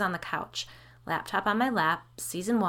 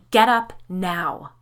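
urgent, slow, much louder command; nearly yelling to make her leave; 9 dB louder, slower than usual. Acted. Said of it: "Claire nearly yelled".

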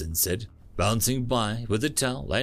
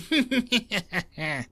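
laughing; 9 semitones higher than usual. Real, not acted. Laughter after joke.

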